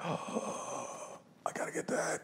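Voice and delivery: whispered longing; seeing the old wrestling poster at a bar, awed whisper. Retold or imitated. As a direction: breathe it like a collector's find. Wanting the poster, hushed.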